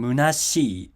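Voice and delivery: "munashii" slowly, pitch held up through shi; "munashii" with the accent on shi; drop after shi. Shown as a demonstration. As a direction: let "munashii" fall only after "shi". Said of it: "it drops after shi".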